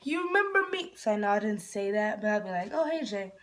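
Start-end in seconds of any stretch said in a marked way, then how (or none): 0.0-0.9 excited squeal. Retold or imitated. Excited reply when her crush says hi. 2.7-3.3 mock casual. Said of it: "pretend, like, to be cool".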